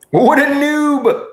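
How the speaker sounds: high, slow mock scorn; mock outrage. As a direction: scoff at the noob. Teasing himself over chat.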